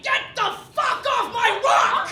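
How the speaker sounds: high, loud imitated yell; angry yell. The stabber yelling at the actors, high loud yell. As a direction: bellow at them to clear off. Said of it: "he's like".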